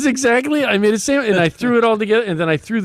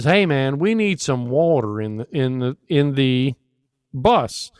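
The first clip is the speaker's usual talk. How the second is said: low drawled country voice; fake country accent; 7 semitones lower than usual. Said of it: "a fake country accent".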